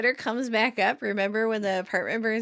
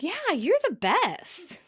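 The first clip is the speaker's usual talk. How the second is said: high, slow, drawn-out adoration; gushing gratitude; 9 semitones higher, slower than usual. Retold or imitated. Phoebe to Monica after the hug, grateful. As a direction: gush thankfully, stretching the words.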